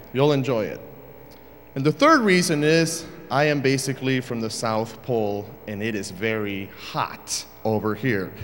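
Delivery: ordinary delivery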